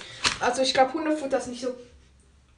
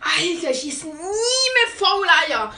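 disgusted outburst; 4 semitones higher, 6 dB louder than usual. Real, not acted. After rotten egg jelly bean.

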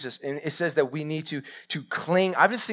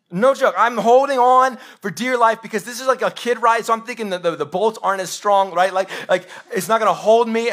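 higher and louder, reliving the ride scare; comic fright, pitched up and loud; mock panic retelling; 6 semitones higher, 5 dB louder than usual. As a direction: tell it like you're still holding on for dear life.

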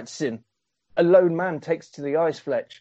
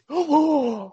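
mock gasp; 13 semitones higher than usual. [name] reacts to quitting.

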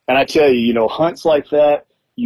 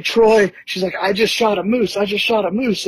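high excited imitation of her calling her husband; imitating Leslie; 9 semitones higher than usual. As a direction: squeal it out like she just got her moose. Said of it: "she calls her husband on speaker".

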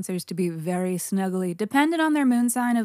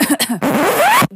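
loud cough; 12 semitones higher, 11 dB louder than usual. Real, not acted. Loud cough after yelling.

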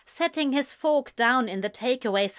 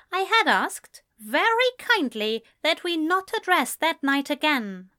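higher-pitched, controlled politeness; strained patience; 7 semitones higher than usual. A narrator's reading. Man asks friend to drop that night, straining for patience. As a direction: keep it polite with effort. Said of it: "with all the patience he could muster".

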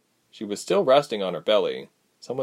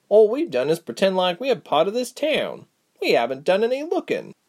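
higher, faster, scolding rant; exasperated Reshma; 13 semitones higher than usual. A narrator's reading. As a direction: rattle off the frustration at her.

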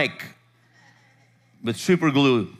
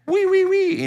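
repeated high, loud mock squeals; fake drunken whooping; 5 dB louder, slower than usual. Pastor mimics the wife carrying on after rebuke, high fake whoops. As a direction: squeal it in bursts like her silly act. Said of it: "Doing her wee thing".

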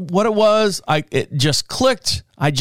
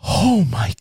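slow, breathy gasp of "Oh my God"; shocked young mom; 3 semitones higher, much slower than usual. Retold or imitated. Young mom in the minivan watching, slow shocked gasp. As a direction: draw it out in shock. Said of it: "she's looking right at me like".

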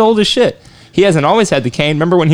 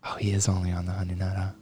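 soft unvoiced whisper; 7 semitones lower, 14 dB quieter, faster than usual. Real, not acted. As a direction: whisper it to yourself. Hushed realization mid-quiz.

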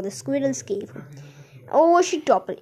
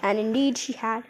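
plain reading voice

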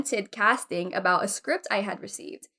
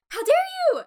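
high, fast indignant outburst; hammed-up "affronted" cry; mock angry outrage; 3 dB louder, much faster than usual. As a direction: snap it in offended disbelief. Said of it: "I was just so angry".

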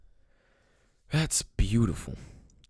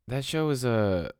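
ordinary talk, nothing marked

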